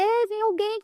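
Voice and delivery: chamado gritado. Retold or imitated. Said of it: "E aí eu comecei a gritar".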